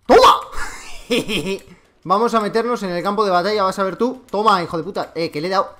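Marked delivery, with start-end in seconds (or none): 0.1-0.4 very high, loud victory shout; triumphant yell. Real, not acted. Scoring a kill. 0.4-1.6 gleeful laugh. Real, not acted. After kill.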